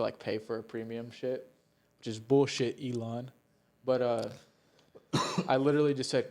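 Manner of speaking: ordinary talk, nothing marked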